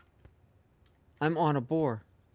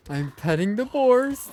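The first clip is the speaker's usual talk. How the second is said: excited; 8 semitones higher, 4 dB louder than usual. Real, not acted. Repeats it with a higher shout.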